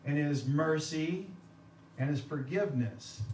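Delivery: God's love and his grace said slowly, each word weighed; slow down and savor each item; slow listing. Shown as a demonstration. Pastor listing what today's preaching stresses, slow and deliberate. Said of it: "what do we emphasize?".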